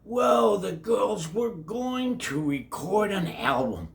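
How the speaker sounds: plain reading voice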